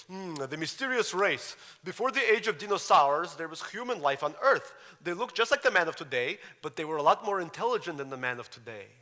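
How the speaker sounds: put-on high, loud reading in a thick Russian accent; boy reading his novel aloud in Russian-accented English; earnest child reader, heavy Russian accent. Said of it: "the Russian accent is still thick".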